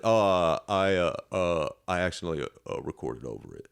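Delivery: imitated awkward stammering; sheepish excuse. Imitates rival's lame excuse.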